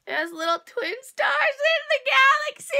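high, loud squeal of delight; excited shout. Real, not acted. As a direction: shout it out, thrilled. Thrilled by the blanket design.

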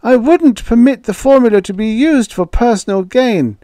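put-on high, loud old man's protest. A narrator's reading. Ewing protesting to Joey, high and loud. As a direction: object in a frail high voice.

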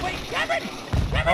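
very high, piercing calls of a name; frantic calling. Real, not acted. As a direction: scream for your teammate. Calling downed teammate in fight.

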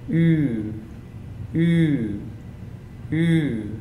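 ooh with rounded lips, tongue forward; German ü vowel. Shown as a demonstration. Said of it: "to pronounce this".

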